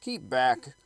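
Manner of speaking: loud, sharp crowd call to stay back; loud warning. Acted. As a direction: hold the crowd back with your voice. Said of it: "said several".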